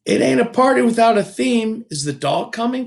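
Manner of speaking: put-on an eager small girl asking; little girl. A narrator's reading. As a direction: Sweetie Pie asking about the theme.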